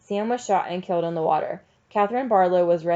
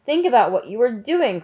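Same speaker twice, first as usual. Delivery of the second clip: loud, higher-pitched plea; crying out; 4 semitones higher, 6 dB louder than usual. A narrator's reading. Teacher to the mob piling up books, crying out. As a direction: cry out to make them stop. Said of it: "cried Miss Catherine".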